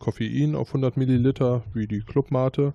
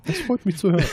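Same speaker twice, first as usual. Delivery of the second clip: laughing; 11 semitones higher than usual. Real, not acted. Laughing at berry-tasting cigar.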